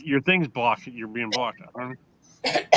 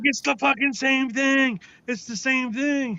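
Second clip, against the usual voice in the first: high, slow mimicked complaint; comic's protest; 10 semitones higher, slower than usual. Comic protesting about the interpreter's sign, mock exasperated. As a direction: stretch it out in a high put-on voice. Acts out comic's punchline, drawn out.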